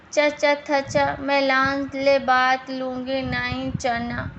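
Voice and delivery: put-on slow, childlike garbled lisp on "मैं लान ले बात लूँगी"; lisping song attempt. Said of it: "निमिता की तोतली आवाज़". (तुतलाकर धीरे-धीरे गाना)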